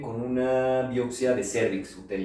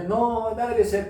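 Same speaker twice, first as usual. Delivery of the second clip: shocked protest; 12 semitones higher, 7 dB louder, much slower than usual. Retold or imitated. Patient protesting, very high.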